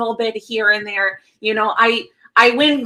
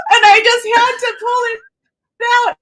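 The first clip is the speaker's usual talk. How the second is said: high mock voice; 10 semitones higher than usual. Playful accent bit, high voice.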